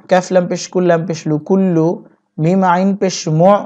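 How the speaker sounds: ordinary delivery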